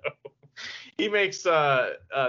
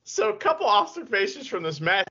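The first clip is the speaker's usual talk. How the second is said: normal talking voice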